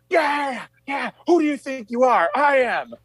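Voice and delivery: hammed-up screaming at the crowd; imitating bowler's yell. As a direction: scream it like a trash-talking bowler who just won. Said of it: "He's like".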